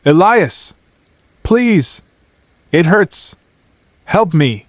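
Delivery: looped voice crying out in pain; pained scream for help. A narrator's reading. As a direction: beg for help as if hurt. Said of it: "the voice behind the basement door screamed again".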